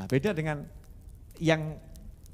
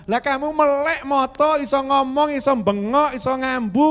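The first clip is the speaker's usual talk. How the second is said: raised voice, listing each point hard; loud scolding; 7 semitones higher, 10 dB louder than usual. Acted. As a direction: shout it as an indignant reproach. Scolding the ungrateful complainer.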